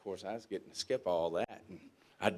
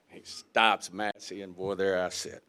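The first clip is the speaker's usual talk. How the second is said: ordinary talk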